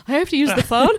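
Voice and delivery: high, loud, whimpering mock panic; mock dread. Nervous adult faced with making a phone call, in hammed-up dread. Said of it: "nowadays we're like".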